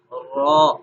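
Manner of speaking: firm "را"; ra sound. Shown as a demonstration. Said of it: "زبان کی نوک اوپر لگے گی".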